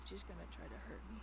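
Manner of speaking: soft worried mutter; quiet aside. Real, not acted. Player muttering her worry to the table. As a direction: say it under your breath.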